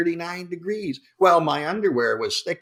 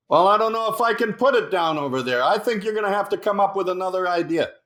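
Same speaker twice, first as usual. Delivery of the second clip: high, raised voice over rotor noise; pilot shouting over chopper; 6 semitones higher, faster than usual. Retold or imitated. Pilot to Hasselhoff in the chopper, shouting high. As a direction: call out over the engine. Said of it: "Why are you talking so loud?".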